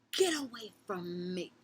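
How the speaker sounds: high, loud, slow warning shout; angry yell. Retold or imitated. To the girls touching her hair, a furious yell. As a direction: yell at them to back off. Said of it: "I went in on them".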